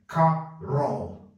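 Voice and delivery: Carol said slowly as CA-rəl with a schwa; slow model pronunciation. Shown as a demonstration. Teacher slowly models Carol against Carl. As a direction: stretch Carol so the schwa shows. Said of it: "Tem que entrar um schwa aqui".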